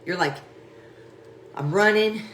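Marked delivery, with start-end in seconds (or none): 1.6-2.2 excited. Retold or imitated. Acting out dodgeball.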